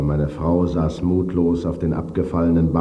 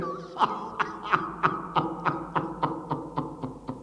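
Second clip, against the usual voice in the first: bitter broken laugh; 13 semitones higher, 7 dB quieter than usual. A narrator's reading. Ghost's bitter breakdown.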